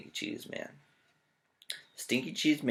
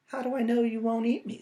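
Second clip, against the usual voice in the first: suspicious cheese man; 11 semitones higher than usual. Acted. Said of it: "said the stinky cheese man".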